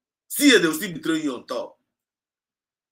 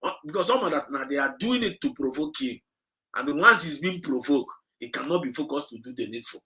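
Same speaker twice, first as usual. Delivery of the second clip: ordinary talk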